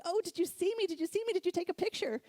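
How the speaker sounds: high, bright rush of delight; excited child calling to her husband on the beach. Retold or imitated. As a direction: squeal it like you just did the impossible. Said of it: "I was so excited".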